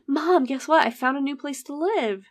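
high, eager announcement; lightly hammed-up eager delivery; acted excitement. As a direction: imitate a son bursting with news. Son announcing happy news to mom.

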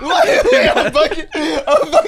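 words through giggles; can't stop laughing. Real, not acted. Cracking up after joke.

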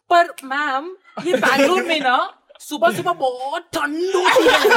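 mock the classmate whining to the teacher; imitating the classmate. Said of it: "and she goes".

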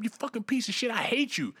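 imitating the angry protester; retold yelling. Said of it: "he's yelling at this fucking cop".

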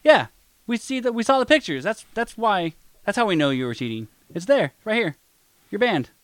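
high, indignant kicking someone out; mock angry accuser. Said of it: "we're like".

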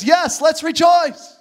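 loud, drawn-out jubilant cry; rejoicing sheep. Acted. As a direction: cheer it out slowly and high. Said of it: "The sheep says".

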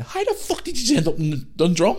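high-pitched retold scolding; exasperated. Dad picking up his stranded son, exasperated. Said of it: "obviously effing and playing".

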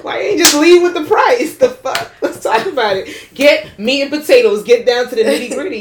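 excited mock bargaining; laughing haggling. Real, not acted. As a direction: bargain loudly, cracking up.

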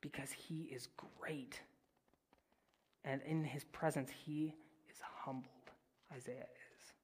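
soft unvoiced whisper; whispered reverence; reverent whisper. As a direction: hush it in awe. Awed reflection on God's greatness.

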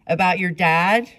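clerk's question. A narrator's reading. Clerk behind the glass asks back, high and loud. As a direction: clerk startled by the girl's words. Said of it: "asked the woman behind the glass".

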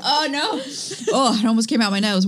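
spluttering through laughter; laughing. Real, not acted. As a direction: laugh while nearly choking on water. Nearly spat out water.

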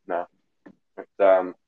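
talking with a mouthful; eating toast while talking; mouth full. Real, not acted. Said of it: "sorry, I'm trying to eat".

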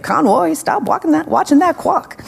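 a hint of a stern teacher. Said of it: "I had this teacher in seventh grade".

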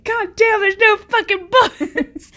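slow loud exasperated yell, laughing; laughing fake anger; mock rage. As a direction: ham up the fury. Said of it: "And I'd be like".

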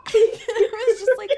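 words through a laugh. Real, not acted. Laughing while retelling disgust.

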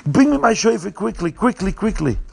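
acting out an urgent call for quick help; urgent request. Rav calls for his shofar as the ship rocks, urgent.

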